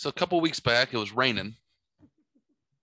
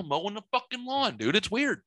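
normal talking voice

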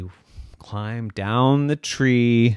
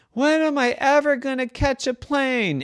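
shouted; 12 semitones higher than usual. Acted. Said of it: "And he shouted".

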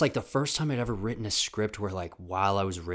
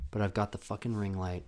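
ordinary delivery

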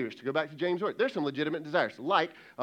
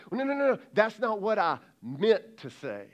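defensive protest; 6 semitones higher, 4 dB louder than usual. Retold or imitated. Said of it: "We say".

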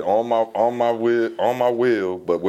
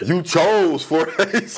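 drawn-out, higher-pitched disbelief; incredulous; 6 semitones higher, much slower than usual. Real, not acted. Friend teasing a buddy about his college, incredulous. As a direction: stretch it out as an astonished question.

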